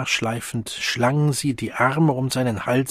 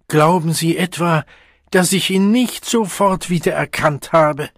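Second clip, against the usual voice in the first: higher, louder indignant question; 5 semitones higher, 5 dB louder than usual. A narrator's reading. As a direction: bristle with offended pride. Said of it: "fragte Feline entrüstet".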